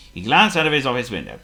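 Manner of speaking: usual speaking voice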